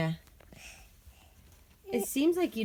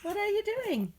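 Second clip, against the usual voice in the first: high-pitched coaxing; 12 semitones higher than usual. Real, not acted. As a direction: lift it way up, playful parent voice. Parent coaxing toddler to talk.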